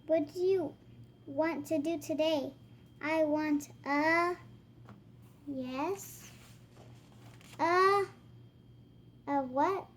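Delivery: put-on lively read-aloud voice for kids; reading to children. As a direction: act out the book for little ones. Reading picture book dialogue aloud.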